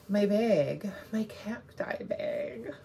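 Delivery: breathy whisper, drawn out slowly; hushed reveal of the bag. Real, not acted. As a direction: lean in and whisper it like a secret.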